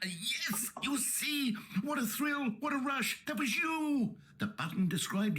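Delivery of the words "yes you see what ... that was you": thrilled. Acted. Narrator thrilled, high.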